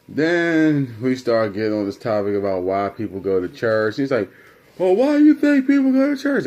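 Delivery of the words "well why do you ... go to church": loud, high-pitched imitation of Dad; challenging dad. Dad quizzing son on churchgoing, challenging. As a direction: throw the question out at a high yell. Dad's challenge in retold argument.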